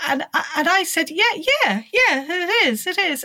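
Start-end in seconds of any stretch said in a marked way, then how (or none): 1.1-3.2 eager yes. Retold or imitated. Eager reply to mum, retold.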